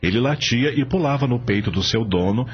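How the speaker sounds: ordinary talk, nothing marked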